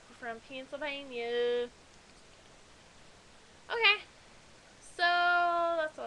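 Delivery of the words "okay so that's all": much higher, louder "Okay"; loud sudden okay. Real, not acted. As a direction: snap back to wrap it up.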